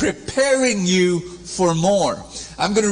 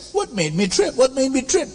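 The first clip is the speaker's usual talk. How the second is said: puzzled finder; 10 semitones higher than usual. Acted. Acting out the finder.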